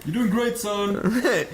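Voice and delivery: laughing mock proud dad; cheering dad voice. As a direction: cheer on the kid from the seats. Voicing encouraging dad.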